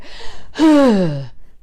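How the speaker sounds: loud, careless, floppy sigh; sloppy sigh. Shown as a demonstration. Voice teacher showing the wrong sigh, sloppy loud sigh. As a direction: throw the breath out with no focus. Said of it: "not that one where you just go".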